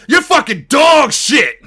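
high, loud, drawn-out insult; hammed-up angry yell; furious taunting shout. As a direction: yell abuse back at pursuers. Taunting officers while running away.

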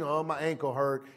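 mock complaint. Mimics people complaining of age.

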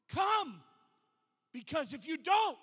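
acted friend urging someone to hide; urgent plea. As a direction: call out a pressing warning. Voices friend's urgent warning to hide.

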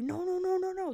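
high, squeaky repeated no; hammed-up high-pitched no no no; mock panicked staff. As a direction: yelp it out like a frantic attendant. Staff refusing at water park.

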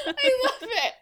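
high, loud, laughing through it; laughing delight. Real, not acted. Host cracking up at the line. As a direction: gush while laughing.

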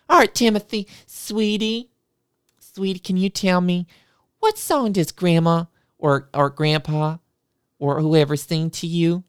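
high, syrupy sing-song teacher; sugary teacher voice. Retold or imitated. Teacher welcoming the kids to music class, high and syrupy. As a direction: gush over the children, sweetly high.

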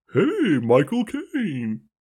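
high slow cheerful Barney voice; Barney the dinosaur. Pretend. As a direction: stretch out a sing-song hey. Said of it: "Barney the dinosaur".

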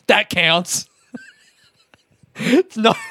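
slow, laughing through the words; chuckling with relief; slow and giggly. Retold or imitated. Happy about crying, laughing.

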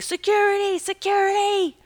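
high, far-carrying shout for security; retold scream. Woman at a water park screams for security. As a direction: yell for security across the park. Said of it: "So I started screaming".

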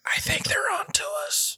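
hushed mock alarm; whisper. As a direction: whisper it like you're being watched. Mock spy panic, whispered.

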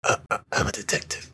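light woman's voice doing Batman; lightly voiced woman doing a Batman growl; man doing a woman's voice. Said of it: "can you do that in your woman voice".

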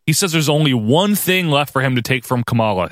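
ordinary talk, nothing marked

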